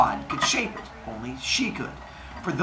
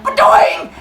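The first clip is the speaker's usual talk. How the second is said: loud high boing effect; Mario-style jump sound; cartoon jump sound; 11 semitones higher, 7 dB louder than usual. Cronus leaping off the mountain, cartoon boing.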